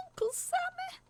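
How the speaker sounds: put-on high little girl's voice, scared; a scared kid blurting it out; high, frightened child voice. A narrator's reading. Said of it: "she mumbled out".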